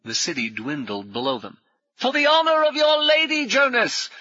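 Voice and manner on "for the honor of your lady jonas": high, loud rallying cry; shouted. A narrator's reading. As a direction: bellow a heroic battle cry. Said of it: "Brummond shouted".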